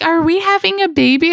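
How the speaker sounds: high, sing-song nurse voice; sweet nurse. Retold or imitated. Nurse asks the mother about the baby, high and cheery. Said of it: "And she's like".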